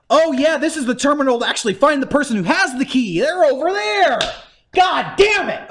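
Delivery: hammed-up fed-up player; mock exasperation. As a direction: act out a game's runaround, peaking on the curse. Acted escalating frustration with game errands.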